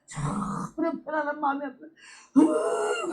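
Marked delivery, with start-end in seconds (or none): 1.9-3.1 slow, crying delivery; tearful retelling; weeping. Retold or imitated. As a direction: let the sob slow her down. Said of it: "울면서 막 우는데요".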